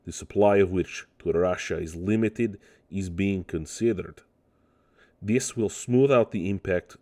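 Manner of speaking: a hammed-up bad Russian accent on the ministry quote; terrible Russian accent. Podcaster reads the Russian ministry statement in a mock Russian accent. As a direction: read it in a deliberately clumsy Russian accent. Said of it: "with a terrible accent".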